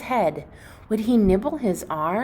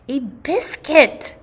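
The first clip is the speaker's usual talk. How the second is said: higher, louder, slow puppy cry; excited bark; 7 semitones higher, 5 dB louder than usual. Acted. The puppies spotting the cookie, barking excitedly. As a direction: shout it out like a thrilled pup. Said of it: "they barked".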